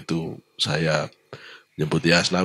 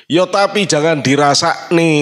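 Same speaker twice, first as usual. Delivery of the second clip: raised, high-pitched telling-off; retold admonishment; 10 semitones higher, 6 dB louder than usual. Friend rebuking a proud official who complains, admonishing. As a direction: cut in with a high retort.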